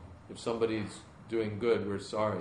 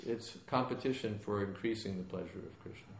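usual speaking voice

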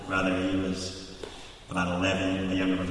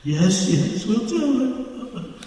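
put-on high, slow little-kid yes; meek kids' promise; 13 semitones higher, much slower than usual. Boys promising the cop, high meek mock reply. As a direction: promise timidly like a scared child.

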